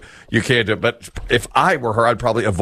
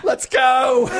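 high comic shout to hurry; frantic getaway. Acted. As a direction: urge the gang to flee, pitched way up. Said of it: "trying to flee a crime scene".

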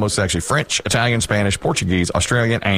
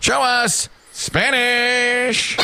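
high, drawn-out game-show call; game-show reveal call; 11 semitones higher, much slower than usual. Pretend. Host calling to the board for Italian, drawn-out reveal call. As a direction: call for the board, stretching each word high.

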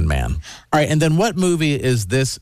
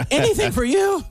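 mock Margot Robbie. Imagined Margot Robbie answers Jason.

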